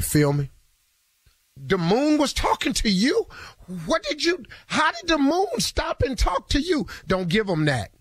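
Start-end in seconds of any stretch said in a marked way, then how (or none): 1.5-6.8 high, incredulous question; mock disbelief. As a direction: play a baffled listener, high up. Mocking imagined listener.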